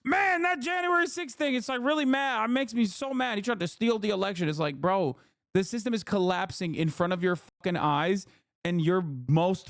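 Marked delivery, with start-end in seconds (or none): 0.1-4.5 high, whiny mock of an upset liberal; imitating a pearl-clutching voter, pitched up; ridiculing someone upset about January sixth, high and whiny. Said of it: "you're over here fucking being like".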